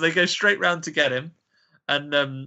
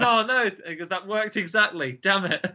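high, hammed-up cartoon dismay; mock dismay; 6 semitones higher than usual. The deputy realising he's been licked, high mock dismay. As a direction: play it like a foiled cartoon villain. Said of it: "and he goes".

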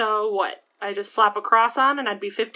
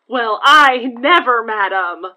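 loud, scandalised gasp and huff; mock offended; 10 dB louder, slower than usual. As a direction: play the shocked prude.

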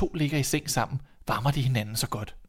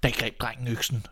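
usual speaking voice

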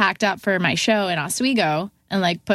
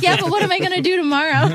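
higher and faster through a laugh; laughing worry; 10 semitones higher, 4 dB louder, faster than usual. Real, not acted. Retelling the firing to the band, laughing in disbelief. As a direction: laugh while asking, half panicked.

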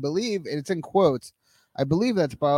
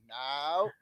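lightly imitated Appalachian "no"; a quiet imitation of an Appalachian father-in-law; hint of an Appalachian accent; imitated father-in-law's accent; 8 dB quieter than usual. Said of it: "That's how he talks".

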